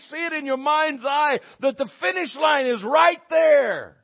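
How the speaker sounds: high, loud, driving sermon delivery; full-force preaching. Real, not acted. Preacher urging church toward the finish line, forceful preaching. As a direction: preach it out to rally the room.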